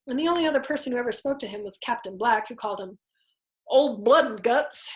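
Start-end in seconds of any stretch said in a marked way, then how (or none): 3.7-5.0 slow, louder mocking nickname; a light merry jeer at a comrade; merry taunting. A narrator's reading. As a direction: taunt him cheerfully with the nickname. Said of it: "in a merry, taunting voice".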